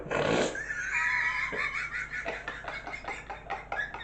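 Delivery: high cackling laugh at own joke; cracking up at the punchline; laughter. Real, not acted. Teller laughs at his own Viagra joke, high cackle.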